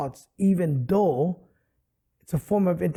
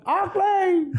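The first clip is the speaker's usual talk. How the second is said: much higher, louder Samoan Augie; Samoan accent; 4 dB louder than usual. Retold or imitated. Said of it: "because of the accent".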